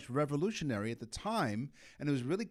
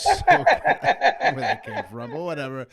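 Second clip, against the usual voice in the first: laughing; 10 semitones higher, 9 dB louder than usual. Real, not acted. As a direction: laugh through the words. Laughing at his own slip.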